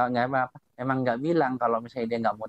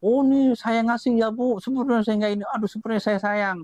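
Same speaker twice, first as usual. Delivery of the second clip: higher, showy announcement of giving; mock shouting; 8 semitones higher than usual. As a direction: call it out for everyone to hear. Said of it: "kamu kira-kira teriak-teriak nggak?".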